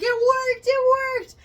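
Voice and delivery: very high, loud jubilant cry; mock triumphant shout. Imitating the husband as the lights come on, mock triumph.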